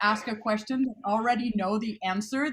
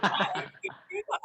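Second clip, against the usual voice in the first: higher, fast, laughing through the question; laughing disbelief; 10 semitones higher, faster than usual. Retold or imitated. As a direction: giggle out the protest. Retold baffled reply, laughing.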